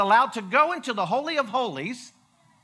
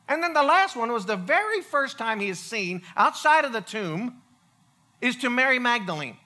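normal talking voice

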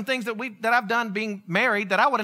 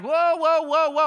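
higher, quick string of halting words; mock calming interjection; 4 semitones higher, slower than usual. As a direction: cut in to halt a grumbling room. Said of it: "the guy was like".

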